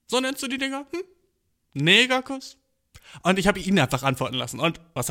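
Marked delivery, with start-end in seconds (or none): 0.1-2.6 much higher, pointed disbelief; mock disbelief. To the boy who said the word, high mock disbelief. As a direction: hold up the word as if it's absurd.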